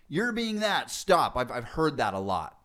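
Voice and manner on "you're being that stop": high-pitched mock reprimand; imitated parent cutting a child short; scolding parent. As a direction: scold in a raised pitch with a punchy stop. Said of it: "Instead of saying, like".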